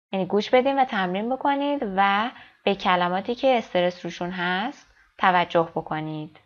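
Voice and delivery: ordinary talk, nothing marked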